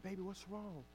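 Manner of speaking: very soft, tender question; gentle soothing father. Retold or imitated. Father to his crying daughter, softly soothing. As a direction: softly coax it out of a child.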